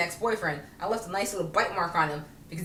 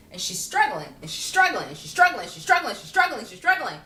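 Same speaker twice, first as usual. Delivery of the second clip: rising, louder with each struggling; repeated struggling growing higher and louder; building repetition; 4 semitones higher, 4 dB louder than usual. Real, not acted. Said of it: "building repetition".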